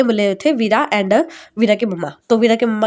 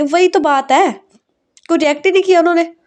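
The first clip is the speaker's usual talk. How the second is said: higher, louder, fast frustrated complaint; upset outburst; 7 semitones higher, 4 dB louder than usual. Acted. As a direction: rattle it off in exasperation. Bride upset fiancé didn't react.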